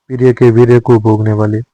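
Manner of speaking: normal talking voice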